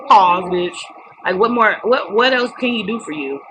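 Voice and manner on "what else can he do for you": normal talking voice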